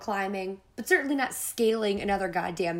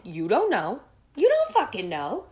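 louder, rising furious snap; angry retort; 3 semitones higher, 6 dB louder than usual. Retold or imitated. Snapping at the friend who promises she'll be fine, angry. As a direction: throw it back in her face.

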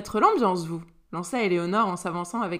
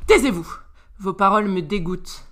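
curt command; 7 dB louder than usual. A narrator's reading. Father silences his son in disgust.

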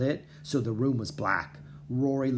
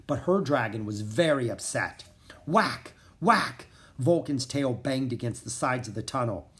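ordinary delivery, nothing marked

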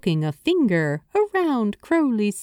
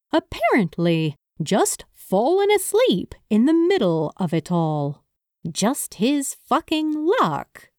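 ordinary delivery, nothing marked

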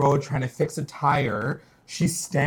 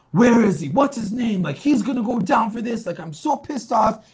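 mock outrage at the culprit; angry Archie; 8 semitones higher than usual. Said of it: "Archie's like".

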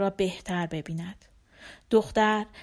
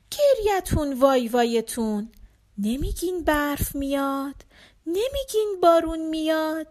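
higher, lilting storyteller's recital; father reciting to his daughter, rising toward the end; recited poem; 8 semitones higher, 4 dB louder than usual. Acted. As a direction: chant the fairy verse to the child.

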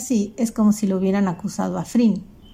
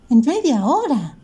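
helpless complaint; 4 semitones higher, 3 dB louder, slower than usual. Acted. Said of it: "se quejó impotente la mamá".

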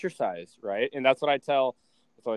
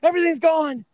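much higher, louder mock wail; panicking mom; 13 semitones higher, 8 dB louder than usual. Mom sending a shelf photo, wailing much higher in mock panic. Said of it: "and she's like".

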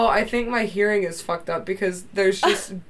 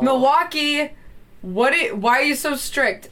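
exasperated rant; 7 semitones higher, 5 dB louder than usual. Retold or imitated. Mock complaint at airport.

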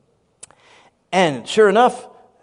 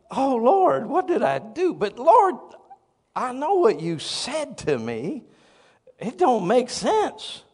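mock pleading; 8 semitones higher, 4 dB louder than usual. Comic pleading prayer to Lord.

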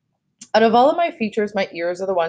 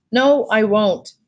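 slow, louder, higher refusal; defiant refusal; 4 semitones higher, 5 dB louder, much slower than usual. A narrator's reading. Child refusing the doctor's promise, defiant. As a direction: dig in on each word.

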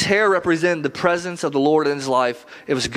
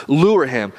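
"Lure" hit hard and louder, falling; stress on lure; 4 dB louder than usual. Shown as a demonstration. Preacher stressing "Lure" to the congregation, punched and louder. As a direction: lean into the word "Lure". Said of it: "That word lure is really important".

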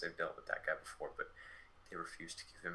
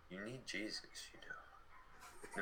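softer, breathy whispered aside; hushed whisper; 10 dB quieter, much slower than usual. Retold or imitated. The hobo urging the friends toward Jesus, in a soft whisper. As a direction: lean in and whisper it like a secret. Hobo's pitch, retold in hushed tones.

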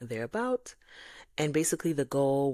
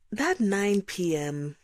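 emphatic; 6 semitones higher, 5 dB louder, slower than usual. Real, not acted. Emphatic complaint about bedtime.

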